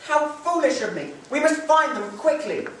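high, loud, urgent; panicked mother. Acted. Mother urging her husband to find the children, panicked. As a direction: cry out in fear for them.

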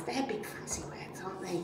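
hushed conspiratorial whisper; whispered aside. Acted. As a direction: whisper it like a secret to the kids. Aside about fancy words.

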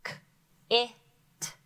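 K, it sounded out sound by sound; sounded-out syllable. Shown as a demonstration. Teacher sounding out the first syllable. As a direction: break K and it apart. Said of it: "short I".

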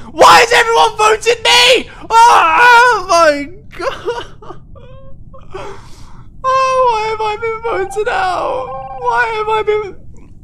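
real frantic wail to lobby; desperate shout. Real, not acted. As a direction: plead with the whole lobby. Voted out himself.

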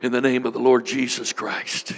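ordinary delivery

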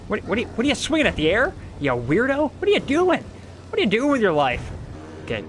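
ordinary delivery

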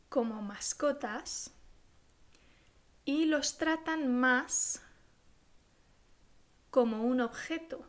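measured teacher dictating, punctuation spoken aloud; dictation. Shown as a demonstration. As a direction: read it out for pupils to write down, phrase by phrase. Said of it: "voy a leeros un texto a modo de dictado".

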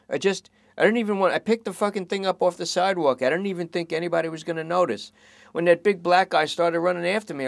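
high, fast, defensive whine; whiny junkie. Acted. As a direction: excuse yourself in a desperate rush. Lester excuses himself.

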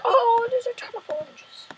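high whispered mock villager; playful pretend villager whisper; squeaky villager voice. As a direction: voice the villager in a tiny hushed squeak. Villager trade reply voiced.

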